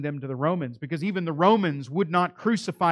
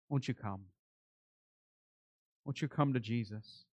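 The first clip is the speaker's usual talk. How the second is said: soft invitation; 3 semitones lower, 8 dB quieter than usual. Real, not acted. Closing altar call to listeners.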